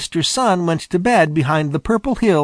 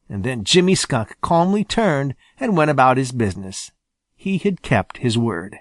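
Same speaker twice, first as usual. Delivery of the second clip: ordinary delivery, nothing marked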